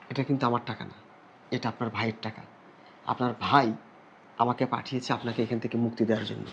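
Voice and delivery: low, soft, sincere reveal; gentle reassurance. Acted. Rescuer revealing her brother sent him, gentle reassurance.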